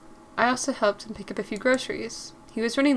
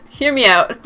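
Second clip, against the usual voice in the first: Hear me out said louder through a laugh; 3 semitones higher, 8 dB louder than usual. Shown as a demonstration. Player asking the chat to hear her out, laughing.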